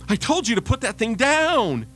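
high, loud exasperated scold; loud, angry mom at the end of her rope; stressed-out mom. Acted. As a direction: bark the order like a worn-out mother. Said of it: "the stressed out mom said".

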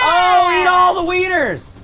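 high, loud slow jeer; taunting shout. Acted. Taunting a beaten enemy in game, loud mock jeer. Trash-talking an enemy player.

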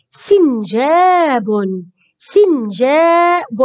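سنجاب slowly, with a long jaa; slow model word. Shown as a demonstration. Teacher models seen with kasra, slow and stretched. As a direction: draw out سنجاب syllable by syllable. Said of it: "سي كسرة".

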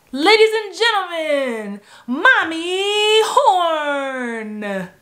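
high, loud, drawn-out showbiz introduction; toddler announcer. Retold or imitated. Toddler introducing mom on tape, imitated grand announcer call. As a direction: announce like a tiny emcee, stretching the name. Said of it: "as a toddler, I would be saying".

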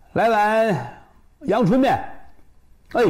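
high-pitched call to the counter; calling an order. Acted. Customer orders plain noodles from shopkeeper, calling out high.

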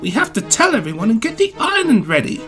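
huffy urgency. A narrator's reading. Thomas urges Gordon to tell everyone, huffy and urgent. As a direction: press for action now. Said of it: "Thomas huffed".

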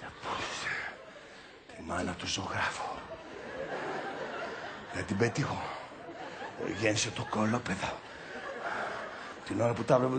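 low grumble under the breath; muttered cursing. Acted. Partner cursing the painter after the row, muttering. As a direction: let the rage sink into private swearing. Anger sinking into muttered curses.

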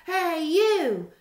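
high, loud squeaky challenge; grouchy ladybug. A narrator's reading. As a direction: pick a fight in a tiny high voice. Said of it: "said the grouchy ladybug".

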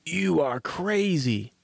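lightly voiced scoffing parson; dismissive parson. A narrator's reading. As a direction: draw it out in mild disbelief.

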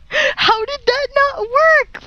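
slow, high-pitched whine; drawn-out high wail; wailing disbelief. Real, not acted. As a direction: wail it out in frustration. Failed attempt in game.

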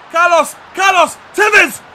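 high, loud calls to the striker; real match-play excitement; excited urging. Real, not acted. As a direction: shout the names with rising urgency. Urging striker during attack.

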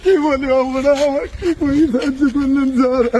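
tearful, overwhelmed thanks; choked-up, wailing gratitude; crying. Real, not acted. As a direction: thank them through sobs. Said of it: "কান্দিস না ভাই".

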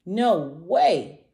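loud, emphatic protest; storybook dinosaur's loud objection; cried refusal. A narrator's reading. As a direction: cry out a stubborn refusal. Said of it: "cried tiny Ranosaurus".

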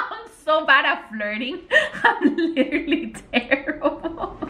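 high, giggly self-mockery; laughing embarrassment. Real, not acted. Woman embarrassed by her own flirting, laughing. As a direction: laugh through the words.

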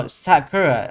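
ordinary delivery

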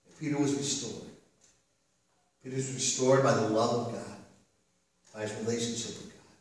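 soft, low, reverent delivery; quiet, solemn sermon moment; hushed preaching. Acted. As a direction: let each phrase settle in a long pause.

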